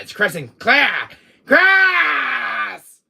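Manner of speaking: high, loud scream of the name; enraged shout. Acted. Villain spotting his nemesis, enraged shout. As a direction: build to a long, high yell.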